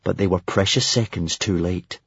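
ordinary delivery

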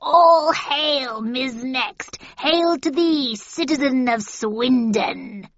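loud, high witch proclamation; witch hailing. A narrator's reading. Witches hail her.

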